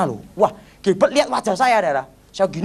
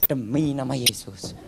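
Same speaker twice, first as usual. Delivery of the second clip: muttered prayer; 7 semitones lower, 7 dB quieter than usual. Retold or imitated. Other pastors praying.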